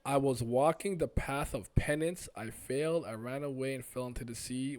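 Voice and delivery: loud, declarative opening easing off; earnest villager. Acted. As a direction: recount it solemnly and forcefully. Voices stranger telling own story.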